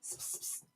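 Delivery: unvoiced psst hiss to a dog; quiet psst to lure the puppy; psst calls. Real, not acted. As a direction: hiss softly to get a dog's attention. Calling her dog over.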